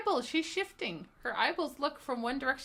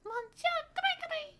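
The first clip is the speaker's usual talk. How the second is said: high call. Acted. Voicing character calling out.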